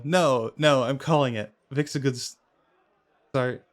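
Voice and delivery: usual speaking voice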